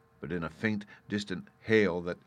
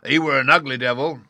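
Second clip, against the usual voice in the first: frightened pirate crying out; shuddering cry; 6 semitones higher, 12 dB louder than usual. A narrator's reading. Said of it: "cried a third pirate with a shudder".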